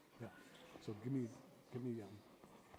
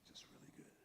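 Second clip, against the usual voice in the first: breathy whisper; whispered aside; 16 dB quieter than usual. Shown as a demonstration. Teacher whispers a quiet aside to the class. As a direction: say it under your breath. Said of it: "storytelling advice".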